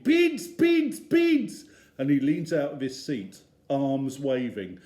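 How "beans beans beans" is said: put-on high, loud, wailing toddler chant; toddler screaming. Little Eddie screaming for beans in the supermarket. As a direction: scream it like a furious little boy. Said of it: "He stops shouting".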